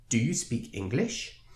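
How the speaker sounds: rising inflection on the final word "English"; rising question tone. Shown as a demonstration. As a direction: lift "English" sharply at the end, as a question. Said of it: "we're using the rising tone".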